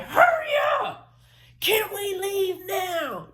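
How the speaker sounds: high, loud, slow screaming yell. Retold or imitated. Screaming at the cop from the back seat, yelling high and slow. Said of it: "And I started screaming".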